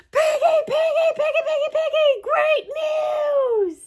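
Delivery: high laughing call of a name; excited calling. Acted. Gerald runs back calling to Piggy, high excited laughing call. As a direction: call out with glee.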